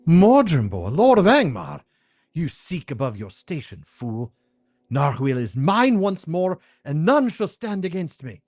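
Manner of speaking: high, haughty villainess scolding; scornful sorceress. Acted. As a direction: sneer at him from above. Sorceress scorns her rival.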